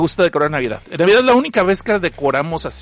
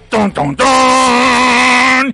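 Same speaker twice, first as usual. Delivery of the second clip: a loud, high, dragged-out "ton, ton, ton" like a plot-twist chord; dramatic sting; 7 semitones higher, 6 dB louder, much slower than usual. Shown as a demonstration. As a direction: sing out the drumroll of a big reveal, slowly. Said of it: "Inserte música de giro". (sonido de giro dramático, alto y muy lento)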